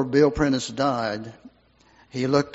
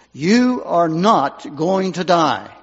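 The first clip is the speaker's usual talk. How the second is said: slow, firm and louder, like a messenger; commanding voice; 3 semitones higher, 6 dB louder, slower than usual. Retold or imitated. Volunteer to dying patient, slow commanding promise. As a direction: declare it with full authority, slowly. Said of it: "he said to her in a voice, perhaps not even his own voice".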